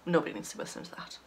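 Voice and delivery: quick breathy whisper to herself; whispered aside. Real, not acted. Embarrassed aside about eating noises.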